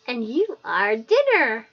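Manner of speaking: loud, slow gleeful threat; creature cackle and yell. Acted. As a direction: cackle then shout the threat. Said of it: "It screams that, in a high pitch".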